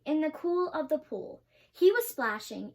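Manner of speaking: ordinary delivery, nothing marked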